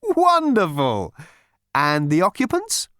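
slow, high gushing praise; delighted psychiatrist. A narrator's reading. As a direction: savour the word. Psychiatrist pleased.

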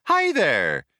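loud, cheery chirp; bright computer. A narrator's reading. Ship computer brightly greeting the crew, loud and chirpy. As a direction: greet like an eager machine. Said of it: "it said brightly".

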